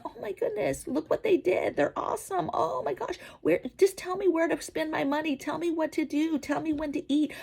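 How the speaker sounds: high mocking voice of a gullible crowd; mock gullible sheep. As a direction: squeak it out like a dim, adoring follower. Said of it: "all the sheep are like".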